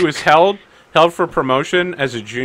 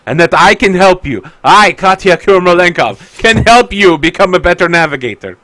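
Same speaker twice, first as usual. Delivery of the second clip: higher, louder, grandly emphatic; overcome with emotion; 4 semitones higher, 5 dB louder than usual. Acted. Katya offers to help true love along, dramatically moved. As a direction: declare it like a melodrama heroine.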